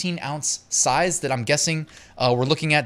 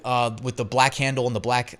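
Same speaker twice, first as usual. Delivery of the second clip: ordinary talk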